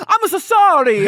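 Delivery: big Italian-chef apology, slowly; over-the-top Italian accent, pleading. Acted. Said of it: "I'm trying to do an Italian accent".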